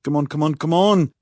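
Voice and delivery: high, rising excited chant; impatient, high-pitched goading; eager urging. A narrator's reading. Ari urging the disaster along.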